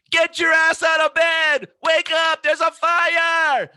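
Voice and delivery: very high, loud yell of alarm; frantic shouting. Retold or imitated. Waking sleepers in a fire, screaming frantically. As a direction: scream them out of bed. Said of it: "And I am".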